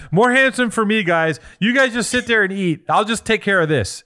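hint of a smug buddy hogging the looks; mock Sponto voice. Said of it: "He's like".

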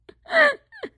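shrieking burst of laughter; high squealing laugh. Real, not acted. Laughing at hungover staff.

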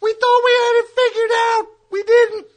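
hammed-up cry, high and loud; mock anguished shout. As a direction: wail it out. Gary character cries out.

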